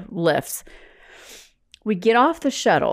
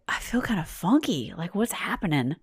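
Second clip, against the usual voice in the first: quiet, faint; 7 dB quieter than usual. Retold or imitated. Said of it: "And I was like".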